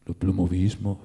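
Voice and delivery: puzzled, questioning echo of the word L'oplomovismo; perplexed. A narrator's reading. The writer echoing Stolz's strange word, perplexed. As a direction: repeat L'oplomovismo as a baffled question. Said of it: "ripeté il letterato perplesso".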